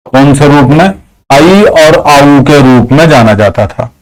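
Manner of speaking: ordinary talk, nothing marked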